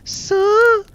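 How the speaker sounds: very high, squeaky "sir, sir"; high-pitched schoolgirl. Retold or imitated. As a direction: squeak it like a schoolgirl begging teacher. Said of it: "it was high pitched as well".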